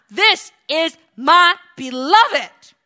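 loud, high, slow this is my beloved; God proclaiming his beloved son; booming voice of God. Acted. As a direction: proclaim it slowly from heaven. Voicing God's proclamation.

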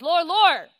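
loud showy calling out; mock fervent cry. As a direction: ham up a hollow devotion. Mocking empty lip service.

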